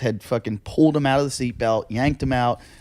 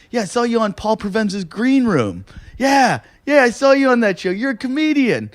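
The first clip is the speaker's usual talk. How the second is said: much higher, eager fan voice; excited fan; 10 semitones higher than usual. Retold or imitated. Bystander recognizing the comic at the crash, eager high voice. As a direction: gush like a starstruck stranger.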